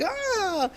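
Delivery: high delighted squeal; child's happy squeal. Retold or imitated. Boy gets a goodie bag, squealing with delight. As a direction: squeal like a thrilled little boy.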